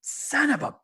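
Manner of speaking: high, slow, strained curse; acted pained cursing. Stumbling wrestler curses after the punch, strained acted pain. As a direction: squeeze the curse out through pain. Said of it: "And he's like".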